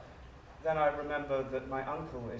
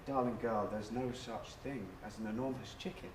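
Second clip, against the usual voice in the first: low, soft reassurance; gently dismissing a bad dream; soothing grandmother; 4 semitones lower, 9 dB quieter than usual. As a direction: comfort a frightened child, low and hushed. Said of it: "puts her arms around me".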